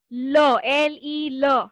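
"ल" said slowly, short schwa; drawn out "ल"; slow clear ल. Shown as a demonstration. Teacher slowly modelling "ल" for class. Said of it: "ले नहीं बोलने का".

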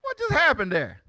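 startled high exclamations; shocked. Real, not acted. Watching the video, shocked by what he sees. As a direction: cry out in surprise at the clip.

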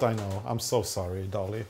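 ordinary delivery

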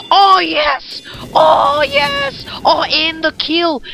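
loud, high cheer; high, exultant gamer yell; excited celebrating. Real, not acted. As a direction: crow over the kill. Celebrating a kill in game.

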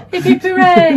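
laughing hip hip hooray; giggly mock cheer. Real, not acted.

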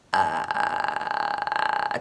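very low creaky rattle, drawn out; vocal fry. Shown as a demonstration. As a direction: creak the vowel slowly from the throat. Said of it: "これ真似してくださいね".

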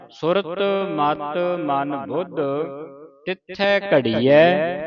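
slow chant, break after ਘੜੀਐ; pause after ਘੜੀਐ. Shown as a demonstration. Teacher recites with the correct break. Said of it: "ਇੱਥੇ ਵਿਸਰਾਮ".

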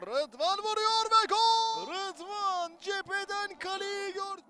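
slow stretched goal call; screaming football commentator. Real, not acted. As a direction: drag out the goal shout.

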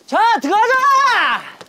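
long, high, drawn-out game-start call; rally the team with a stretched-out shout. Real, not acted. (길게 끄는 시작 구호)

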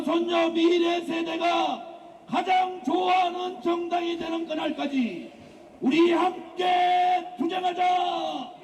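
high, slow shouted battle cry; rally the crowd to fight; roaring rallying speech. Real, not acted. (외치는 투쟁 구호)

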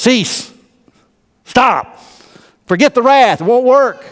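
high, ringing commands; commanding call. Real, not acted. Pastor commands his church to stop the wrath, ringing high. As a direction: call each word out sharply.

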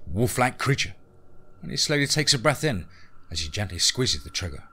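usual speaking voice

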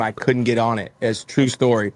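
usual speaking voice

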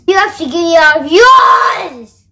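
loud gleeful sing-song demand; triumphant sing-song. Acted. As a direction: gloat it, sing-songy.

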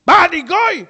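high, loud, offended shout; insulted outburst. Acted. Congregant insulted at being called a gentile, loud outburst. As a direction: fire it back, deeply insulted.

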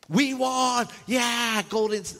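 high, loud victory whoop; mock fan yelling. Preacher plays Cleveland fans still in the arena, mock yelling. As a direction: yell like a triumphant sports fan, hammed up. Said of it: "still yelling at the Golden".

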